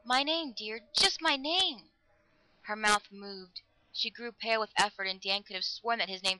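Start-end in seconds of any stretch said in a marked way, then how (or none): 0.0-1.9 high, drawn-out begging; slow down and beg, pitch raised; desperate plea. A narrator's reading. Lover begging to hear his name, desperately. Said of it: "he pleaded desperately".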